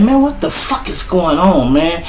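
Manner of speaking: high, exasperated whine; a cop griping that he's in trouble; whiny cop. Retold or imitated. Officer griping to his partner, high and whiny. Retold cop voiced complaining.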